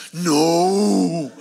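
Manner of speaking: loud, long-drawn mock no; hammed-up, stretched outcry; horrified denial. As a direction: wail it out in exaggerated horror. Classmate's horrified denial, acted out.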